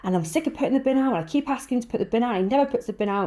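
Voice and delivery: high, fast whiny complaining; moaning rant. Retold or imitated. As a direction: rattle off the complaint in a whine. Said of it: "having an absolute moan".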